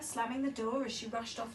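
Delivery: ordinary delivery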